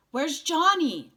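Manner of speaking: loud, high, slow frightened question; frightened cry. Acted. Boy in the ambulance crying out for his friend, frightened. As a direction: cry it out in panic. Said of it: "I cried, frightened".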